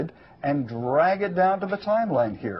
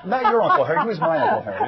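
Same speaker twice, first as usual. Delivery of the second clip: laughing; 11 semitones higher, 5 dB louder, faster than usual. Real, not acted. Joking aside about Uncle Harry.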